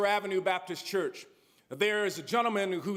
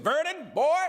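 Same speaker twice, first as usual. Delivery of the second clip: loud, higher, rough order to a prisoner; mock sheriff bark; 6 semitones higher, 4 dB louder than usual. Sheriff at the police station ordering the preacher, loud mock bark. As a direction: bark his name down like a sheriff.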